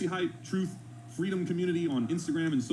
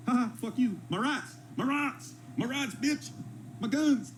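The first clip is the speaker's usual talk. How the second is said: high, taunting parody chant of rights; mocking gun-rights guy; 6 semitones higher, slower than usual. Reactor parodying the clip's gun threats, high and taunting. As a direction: sneer it like a smug gun nut.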